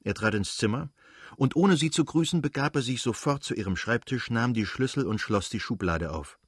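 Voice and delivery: usual speaking voice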